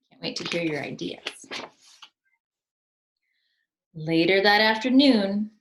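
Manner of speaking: normal talking voice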